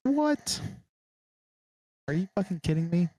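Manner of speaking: high disbelieving outburst; shocked. Retold or imitated. Hearing a stranger knows his parents, high shocked outburst. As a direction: jump up in pitch in disbelief. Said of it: "And I'm like".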